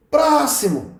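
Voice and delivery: high-pitched call for the next customer; mock shout. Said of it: "eles gritam igual, falando assim". (grito encenado de caixa chamando)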